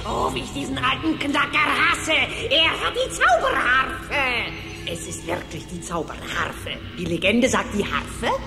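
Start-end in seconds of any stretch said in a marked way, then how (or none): 0.0-4.5 high, worked-up witch grumble; villainous envy. Acted. Witch hating the old miser who has the harp, high envious outburst. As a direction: rage theatrically, stretching the last words. Witch jealous of rival's find.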